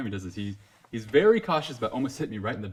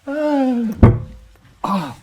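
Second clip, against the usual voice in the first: starstruck squeal; 9 dB louder than usual. Retold or imitated. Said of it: "I wanted to faint".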